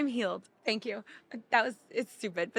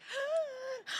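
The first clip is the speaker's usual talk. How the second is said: high, soft gasp of delight; re-enacted high-pitched gasp; excited gasp; 8 dB quieter than usual. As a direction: suck in an amazed breath. Retelling proud shoelace moment.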